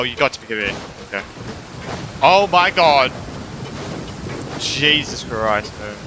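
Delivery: usual speaking voice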